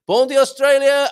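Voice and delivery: loud, high put-on Australian greeting; mock Australian accent. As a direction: ham up an Aussie hello. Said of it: "People do not talk like that in Australia".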